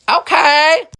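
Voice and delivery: okay shot way up high and loud; stunned okay. Retold or imitated. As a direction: act floored but go along. Reacting to the love spell plan.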